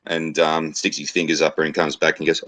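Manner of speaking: usual speaking voice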